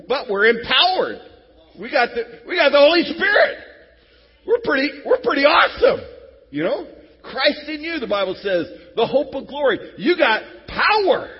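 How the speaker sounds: high, loud shouted preaching; rally the room at full force. Real, not acted. Pastor shouting to the congregation about power.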